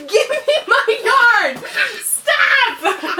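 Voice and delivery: high laughing yell, half playful; laughing shout. Real, not acted. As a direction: shriek it through laughter. He grabs her yarn, laughing.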